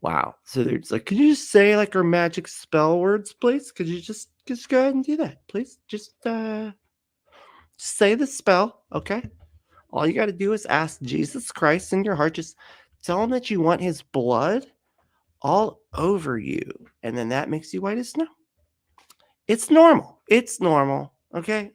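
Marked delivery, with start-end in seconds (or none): 1.1-18.3 high, wheedling mock evangelist voice; sing-song mock plea to accept Jesus; mocking pushy preacher. Mock Babylon Bee asking for salvation. 19.5-21.7 high insistent mock reassurance; hammed-up nervous cult insistence; mock reassuring. Mock reassurance, blood cult joke.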